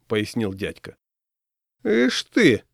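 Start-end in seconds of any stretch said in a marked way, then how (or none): 1.8-2.6 put-on high-pitched, disapproving old woman; raised-pitch reproach. A narrator's reading. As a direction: tut at them in a high old-lady voice. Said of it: "неодобрительно сказала старушка".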